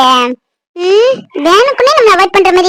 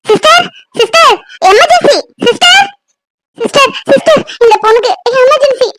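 urgent call; 6 semitones higher than usual. Acted. Calling nurse for an emergency.

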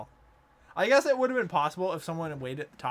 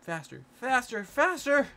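urgent cheering; 6 semitones higher than usual. Real, not acted. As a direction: urge them on, building up. Urging a racer on.

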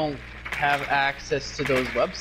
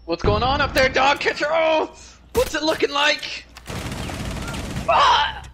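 excited yelling; 12 semitones higher, 5 dB louder than usual. Real, not acted. Panicked shouting during gunfight.

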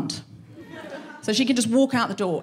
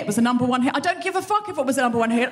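ordinary delivery

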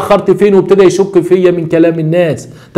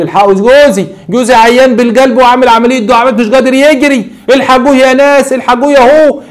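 high, loud panicked calls; frantic cry for help; 8 semitones higher, 4 dB louder than usual. Retold or imitated. Wife running out, calling neighbours to save husband, feigned panic. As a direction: shout for the neighbours to save him. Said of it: "والزوجه بتجري بتقول".

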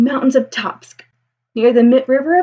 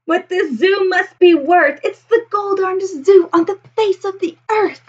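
cheering crowd; 8 semitones higher than usual. Acted. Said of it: "they'll all cheer".

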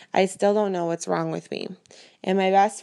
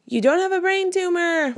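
excited good news; 11 semitones higher, 4 dB louder than usual. Acted. Mom wakes daughter with good news.

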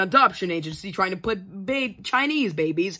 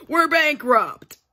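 panicked blurt; 7 semitones higher, 5 dB louder, slower than usual. Acted. Sudden panicked announcement.

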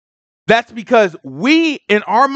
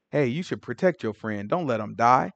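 low, hushed, coaxing devil; tempting Satan; 9 semitones lower, 10 dB quieter than usual. Retold or imitated. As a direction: slip the idea in softly, like a tempter.

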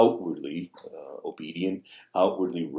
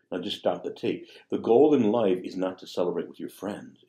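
ordinary talk, nothing marked

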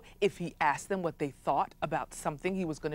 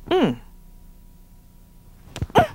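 mosquito hum; 11 semitones higher, 5 dB louder than usual. Acted. Mosquito buzzing in story.

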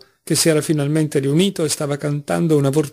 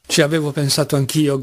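usual speaking voice

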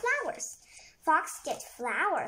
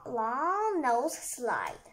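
slow, drawn out long; stretched out; much slower than usual. Acted. Child stretching word long.

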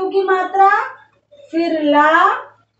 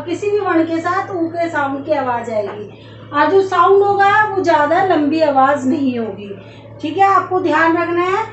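normal talking voice

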